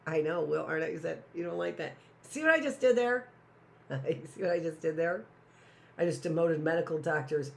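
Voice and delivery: ordinary talk, nothing marked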